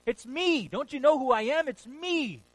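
a triumphant cry of recognition; ringing proclamation. Acted. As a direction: call it out across the water with joy. Jesus revealing himself on the water.

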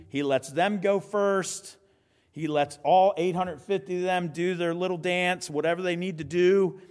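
usual speaking voice

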